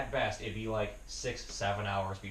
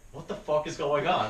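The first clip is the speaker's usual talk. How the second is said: louder, mildly exasperated demand; retold rising anger; 4 semitones higher, 7 dB louder than usual. Calling his panicking brother back, mildly angry. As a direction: imitate growing anger on a worried phone call. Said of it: "I'm getting angrier".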